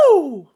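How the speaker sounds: loud high "woo"; hammed-up cheer; excited whoop. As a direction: let out a big high whoop. Caps the acted excited Putin outburst.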